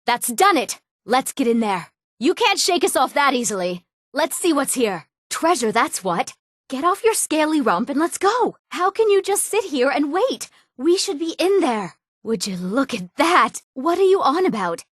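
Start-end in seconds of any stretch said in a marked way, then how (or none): 2.2-3.8 higher-pitched, insistent taunt; defiant challenge. Acted. Rival treasure hunter challenges others. 6.7-12.0 high-pitched, exasperated nagging; impatient urging. Acted. Treasure hunter tells waiting companion to get off and go, impatient urging.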